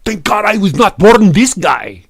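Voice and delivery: put-on loud, high, hammy Russian accent; mock Russian troll. Troll posting an insult about the streamer, loud mock Russian accent. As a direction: proclaim it in a thick, broken-English Russian accent. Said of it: "And Gregor go".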